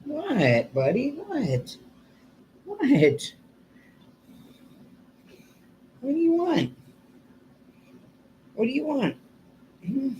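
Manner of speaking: high, coaxing voice for the pet; pet talk. Real, not acted. Talking to the pet Hank.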